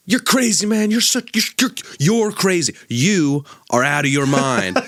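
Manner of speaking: put-on high, incredulous drunk ranting; town drunk. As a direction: yell it like a drunk stunned at you. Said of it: "And he's like".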